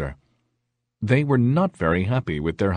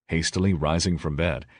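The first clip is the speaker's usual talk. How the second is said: usual speaking voice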